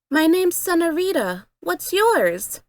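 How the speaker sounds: put-on high, friendly young girl voice; sweet little girl. A narrator's reading. Senorita introducing herself to the narrator, sweet and high. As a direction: introduce yourself brightly to a new friend. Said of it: "she asked again".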